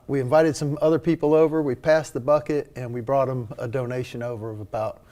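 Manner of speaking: ordinary delivery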